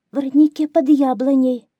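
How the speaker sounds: high, loud little hare; hare's answer. A narrator's reading. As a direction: blurt it out as a scared hare. (заяц, высоко и громко)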